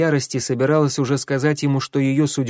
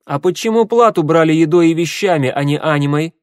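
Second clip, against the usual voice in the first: loud, higher-pitched insistent question; stubborn demand; 6 semitones higher, 7 dB louder than usual. A narrator's reading. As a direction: push the question stubbornly and loudly. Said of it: "упрямо спросила Гвен".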